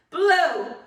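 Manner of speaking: a big, high, loud burst of "Blow". Shown as a demonstration. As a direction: push the word out like blowing out candles. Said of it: "I want you to blow out the candles".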